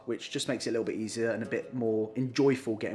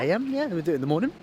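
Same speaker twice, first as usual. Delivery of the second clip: mocking; 7 semitones higher, much faster than usual. Mocking partner's mix-up.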